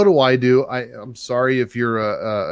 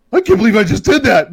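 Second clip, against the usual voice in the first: high, louder disbelief; buddy stunned at his own stomp; shocked buddy; 9 semitones higher, 7 dB louder than usual. Retold or imitated. Buddy after stomping rodent, high disbelief. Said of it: "he's like".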